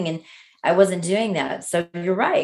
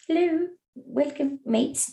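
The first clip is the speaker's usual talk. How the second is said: put-on Australian accent; 9 semitones higher, 3 dB quieter, slower than usual. Imitating the Australian woman.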